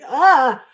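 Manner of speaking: sudden hammed-up pleasure gasp; mock ecstatic gasp. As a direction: gasp as if overcome, demonstrating. Acting out the climax feeling.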